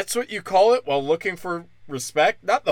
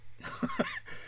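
high laugh; 14 dB quieter than usual. Real, not acted. Laughs at own joke.

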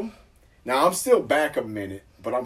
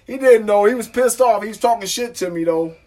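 high, loud excited telling; worked up; 11 semitones higher, 11 dB louder than usual. Retold or imitated. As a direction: get fired up telling it. Agitated retelling of angry cop.

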